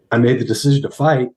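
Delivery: normal talking voice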